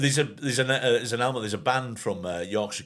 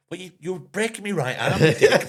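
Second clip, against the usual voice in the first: indignant, higher-pitched yelp through the words; mock pained protest; 8 semitones higher, 4 dB louder than usual. Fight loser protests about his arm, mock pained. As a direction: protest as if your arm's being twisted, half laughing.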